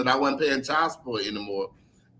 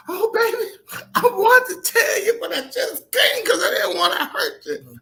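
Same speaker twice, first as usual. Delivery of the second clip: much higher, half-laughing imitation of a tearful mother; imitating crying mom; 4 dB louder than usual. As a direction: voice mom choking up through laughter. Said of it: "and she started crying".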